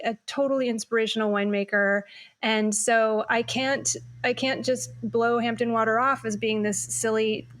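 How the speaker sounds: ordinary talk, nothing marked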